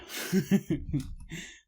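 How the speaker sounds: short embarrassed laugh. Real, not acted. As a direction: laugh it off lightly. Laughing at own story.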